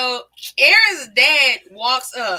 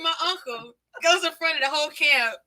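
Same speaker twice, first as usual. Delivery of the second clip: usual speaking voice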